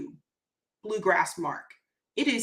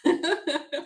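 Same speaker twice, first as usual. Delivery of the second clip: laugh; 5 semitones higher than usual. Real, not acted. Laughing at comment.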